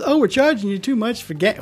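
mock government voice. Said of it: "the government says".

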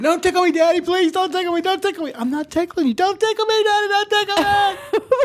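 put-on high squealing child voice, begging; being tickled. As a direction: squeal like a tickled little girl. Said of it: "she's like".

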